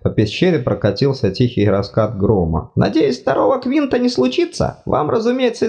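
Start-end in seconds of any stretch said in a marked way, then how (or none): 2.7-5.7 high fussy god voice, apologetic; flustered deity, much higher pitched; embarrassed god. Acted. God speaks.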